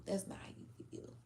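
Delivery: quick, quiet dismissive whisper. Retold or imitated. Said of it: "people are fully like".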